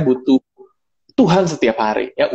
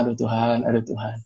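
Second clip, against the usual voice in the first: softer, lowered plea to God; resigned sighing prayer; 3 semitones lower, 8 dB quieter than usual. Retold or imitated. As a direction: retell a dismayed prayer under the breath. Muttered prayer while walking away. (keluhan pelan kepada Tuhan)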